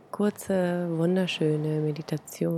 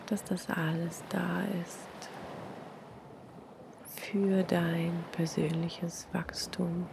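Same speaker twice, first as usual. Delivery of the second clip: guided meditation; 6 dB quieter than usual. Acted. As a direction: breathe it out softly, long pauses.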